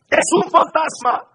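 high-pitched cry at normal volume; mock frightened cry. Preacher as disciples seeing a figure on the sea, frightened cry. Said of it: "de miedo se pusieron a gritar".

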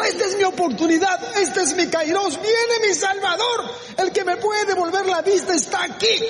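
high-pitched, excited proclamation; elated realization. Acted. Bartimeo ve llegar su oportunidad. (exclamación eufórica)

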